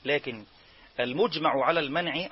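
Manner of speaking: ordinary talk, nothing marked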